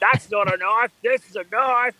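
put-on high-pitched, broad Australian accent; Crocodile Dundee. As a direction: quote the film line in exaggerated Aussie. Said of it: "You do that one well".

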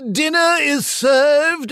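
high, slow, loud British butler parody; Monty Python style British send-up; mock British accent. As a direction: draw it out in hammed-up posh British. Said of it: "my sister and I used to do that all the time".

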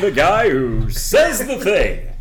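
high, slow, hammed-up announcer; radio announcer voice. Host showing off trained radio voice, hammed-up announcer. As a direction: swoop grandly through each word like a radio host. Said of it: "this beautifully trained radio voice".

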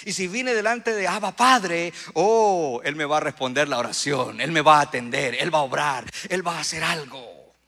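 full-voiced, very loud exultant declaration; fervent preaching shout. Real, not acted. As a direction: cry out your faith at full force. Preacher builds to fervent climax.

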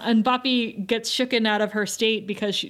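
ordinary talk, nothing marked

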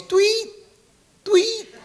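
very high chirping bird call; bird tweet. Retold or imitated. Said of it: "And he goes".

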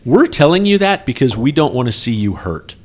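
usual speaking voice

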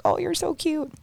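high cooing voice for a cute pet; cooing baby talk. Retold or imitated. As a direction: coo it up high like talking to a baby animal.

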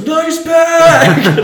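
high, loud yell from a car window; big mock yell; shouted call-out. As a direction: holler it out to someone across the lot. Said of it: "your boss, like, rolls down the window and goes".

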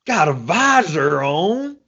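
high, slow put-on old man; old coach voice. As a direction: answer like the disgusted old coach. Answering in coach voice.

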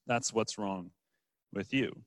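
preacher voicing Jesus' rebuke gently and low; soft solemn close. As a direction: let the verdict drop quietly. Closing line of Jesus' rebuke.

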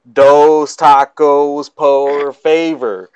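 slow Southern drawl on broken Spanish; Arkansas drawl Spanish. Retold or imitated. Brother-in-law orders at a taco stand, slow Southern drawl. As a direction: drag out Dos tacos por favor in a Southern drawl. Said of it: "that's the kind of accent and the draw that they have".

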